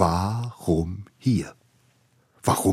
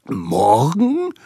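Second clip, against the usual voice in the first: higher, louder shocked question; shocked squeak; 7 semitones higher, 5 dB louder than usual. A narrator's reading. Boy hearing the guest comes tomorrow, shocked and loud. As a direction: choke out the word in disbelief. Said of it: "sage ich postend".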